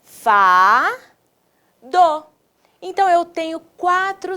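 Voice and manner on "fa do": long rising "Fa", pause, then "do"; drawn-out syllable demo "Fa, do"; slow syllables. Shown as a demonstration. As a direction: separate "Fa, do" for learners. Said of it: "Vamos falar pausadamente?".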